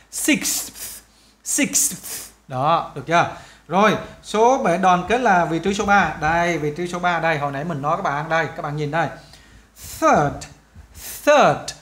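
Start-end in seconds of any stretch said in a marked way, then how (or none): 0.1-1.9 "Six" ending in a crisp breathy KS; released ks. Shown as a demonstration. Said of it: "các bạn cũng phải bung được". 10.0-11.7 "Third" with the tongue between the teeth and a released final D. Shown as a demonstration. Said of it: "đưa cái đầu lưỡi ra".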